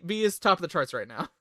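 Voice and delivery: ordinary talk, nothing marked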